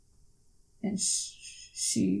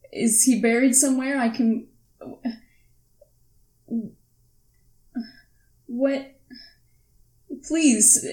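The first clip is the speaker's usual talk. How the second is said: louder, higher, desperate demand; tearful pleading; 4 semitones higher, 6 dB louder than usual. Acted. Daughter begs Claire for news of her father, tearful and desperate. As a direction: plead for answers, close to tears. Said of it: "starting to well up with tears".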